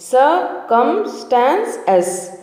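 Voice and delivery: slow chunked model; chunked pronunciation. Shown as a demonstration. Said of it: "చంకు మెదడులో ఎలా పలకాలి".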